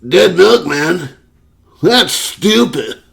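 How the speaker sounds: high, slow sneering taunt; mocking coworker. Coworker sneering at the narrator's band shirt, slow high mock.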